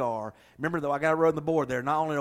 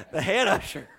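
puffed-up, higher and a bit louder "The head usher"; mock proud; 4 semitones higher, 4 dB louder than usual. Mocking the proud head usher's boast, puffed up. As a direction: say it like a proud title. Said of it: "And very proud of that".

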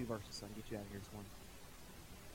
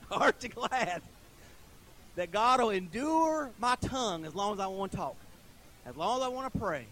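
louder, higher preaching, pressing each phrase; preaching at full force; 4 semitones higher, 6 dB louder than usual. Acted. Pastor assuring the congregation God listens, louder preaching. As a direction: preach it out, hitting the key words.

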